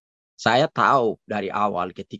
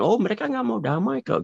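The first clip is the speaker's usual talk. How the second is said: mocking quote; 13 semitones higher, faster than usual. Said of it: "Niat untuk damai dia digambarkan".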